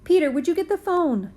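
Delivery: a hint of a mother calling her son; Mom calling. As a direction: call out like a mom across the house. Mom's voice in story.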